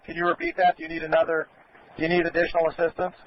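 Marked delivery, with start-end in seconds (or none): none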